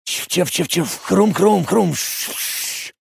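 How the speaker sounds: imitated crackling radio interference; radio static. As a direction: make the noise of a dead radio channel. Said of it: "изобразил радиопомехи".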